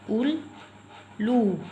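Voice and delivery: उल्लू slow, long ऊ held, falling at the end; drawn-out oo. Shown as a demonstration. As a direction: draw out उल्लू syllable by syllable. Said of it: "ऊ की मात्रा जो ज़्यादा स्ट्रेस के साथ".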